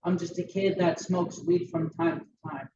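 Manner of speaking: ordinary talk, nothing marked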